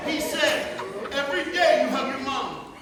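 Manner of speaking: high, very loud retold quote; big, high-pitched preacher shout; shouted storytelling. Real, not acted. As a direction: holler it over the crowd. Shouting over the crowd's laughter.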